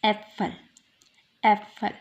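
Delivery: एप्पल opening on a short "eh", as in Äpfel; short umlaut. Shown as a demonstration. Teacher showing umlaut short sound, "eh" opening on एप्पल. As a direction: start एप्पल with a brief open "eh". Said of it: "ए umlaut की कोई sound".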